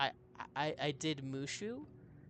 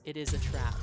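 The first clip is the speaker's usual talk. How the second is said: a louder, hammed-up alien admiral warning; mock sci-fi commander alert; Admiral Ackbar; 8 dB louder than usual. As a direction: boom it out like the fish admiral sounding the alarm. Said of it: "I don't know if I can do his voice justice".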